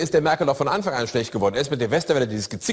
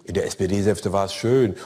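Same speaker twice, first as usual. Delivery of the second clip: slow, lower, lost in pleasant memory; dreamy Merkel; 7 semitones lower, slower than usual. Acted. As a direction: sigh it out like a contented chancellor. Merkel dreamily recalling the sedan chair.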